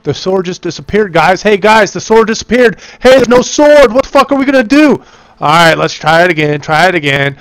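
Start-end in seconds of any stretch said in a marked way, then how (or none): 1.4-5.0 higher and louder, rushing the words in alarm; suddenly alarmed, calling to the team; alarmed surprise. Real, not acted. Sword vanished mid raid.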